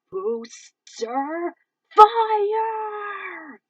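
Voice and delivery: screamed attack name; battle cry. Acted. Pilot calling out an attack, shouting. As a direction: shout the move at full force.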